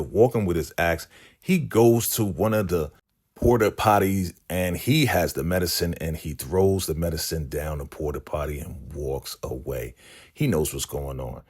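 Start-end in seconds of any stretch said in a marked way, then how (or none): none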